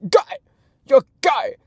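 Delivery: George Bags voice. Pretend. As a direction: yell it like a cartoon bird. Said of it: "just turned into George Bags".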